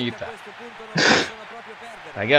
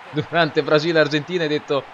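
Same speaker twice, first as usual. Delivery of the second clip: normal talking voice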